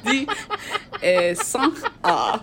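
hammed-up, halting French accent. Shown as a demonstration. Struggling to read chapter numbers to friends, deliberately bad French accent. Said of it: "Should I read it in my French accent?".

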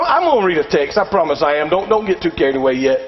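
ordinary talk, nothing marked

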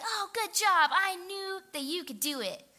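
high, gushing praise in imitation; excited teacher. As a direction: imitate an overexcited teacher cheering someone on. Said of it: "she gets a little more excited".

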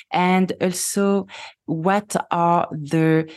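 ordinary talk, nothing marked

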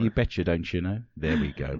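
a Brit's light go at Minnesota folksy talk; mock Minnesotan accent. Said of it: "maybe work a little more".

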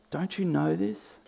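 high, soft sarcastic question. Retold or imitated. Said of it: "can you hear the sarcasm in that".

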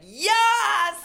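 very high, loud shout of assent; ecstatic yell. Retold or imitated. Inspired comic answering her new mentor, ecstatic yell. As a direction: shriek a wholehearted yes.

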